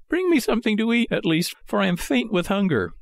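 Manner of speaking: high, hurried puppet plea; high-pitched hungry marionette; pleading Pinocchio. A narrator's reading. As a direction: beg quickly in a small high voice.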